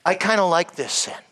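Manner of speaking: usual speaking voice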